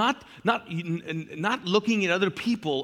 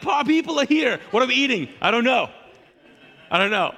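high, loud, frantic I-don't-know; mock panic with guests at the door; flustered host yelling with no plan; panicked host; 7 semitones higher, 6 dB louder, faster than usual. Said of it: "The other person is like".

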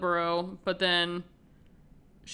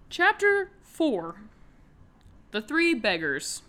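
slow, high and louder dramatic title read; mock chapter announcer; 3 semitones higher, 3 dB louder, slower than usual. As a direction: announce the chapter like a hammed-up audiobook narrator.

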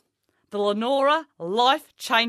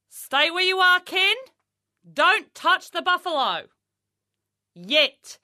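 higher, louder command; loud warning; 7 semitones higher, 6 dB louder, slower than usual. Acted. Coach warning Kenny away from the buffalo, loud and high. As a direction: hold Kenny back sharply.

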